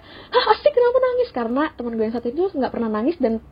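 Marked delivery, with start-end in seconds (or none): 0.0-1.3 high, startled asking; surprised classmates. Retold or imitated. Classmates shocked that Asti is crying, high and startled. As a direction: gasp out the question. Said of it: "semua orang jadi kayak".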